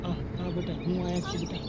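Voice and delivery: tender comforting. Acted. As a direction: hush her gently, keep her calm. Father comforts feverish daughter.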